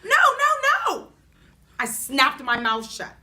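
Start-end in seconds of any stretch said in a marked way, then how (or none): 0.0-1.1 a loud, high yell of no. A narrator's reading. Girl yelling at grandpa to stop wiggling her tooth, loud high yell. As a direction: shriek the no as grandpa grabs the tooth. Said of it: "I yelled".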